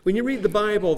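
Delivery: ordinary talk, nothing marked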